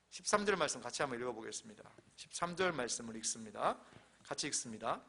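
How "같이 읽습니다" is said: ordinary talk, nothing marked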